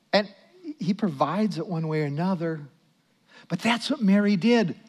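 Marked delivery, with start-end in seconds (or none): none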